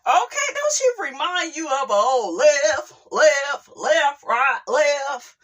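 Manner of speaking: high, loud barked drill commands; drill sergeant calling cadence. Retold or imitated. Mocking the rapper as a drill sergeant calling cadence. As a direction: call the march like a drill sergeant. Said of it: "she's such a drill sergeant".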